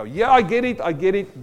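high, loud burst of recognition; eager realization. Retold or imitated. Pastor voices his own quick thought on the verse, excited. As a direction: act out jumping at the answer.

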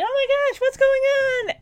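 squeaky high-pitched disbelief; high startled cry of a kid; shocked gasp. Retold or imitated. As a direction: jump way up in pitch, stunned. Michael realizes he is inside himself.

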